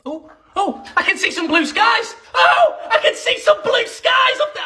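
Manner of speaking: very high, squealing excitement; overexcited discovery. Pretend. Brit spotting blue skies in summer rain, squealing excitement. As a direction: build from small gasps to a squeal.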